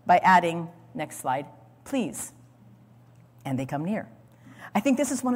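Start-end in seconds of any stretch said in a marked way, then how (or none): 1.8-2.3 softened please. Shown as a demonstration. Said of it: "Joseph softens the command".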